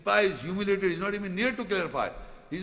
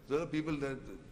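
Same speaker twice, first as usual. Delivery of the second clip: usual speaking voice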